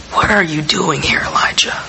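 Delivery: soft, gentle question, fading to a whisper on the name; very kind. Retold or imitated. The Lord gently asking the despairing prophet, soft and kind. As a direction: ask it kindly and quietly, like to someone at his lowest. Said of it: "and asked very kindly".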